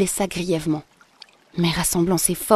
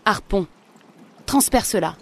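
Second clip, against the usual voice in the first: lance l'ordre un peu plus fort, avec fermeté; ordre avec force; 2 semitones higher, 4 dB louder than usual. A narrator's reading. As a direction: commande ton harpon avec force. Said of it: "il répliqua avec force". (ordre ferme et un peu plus fort)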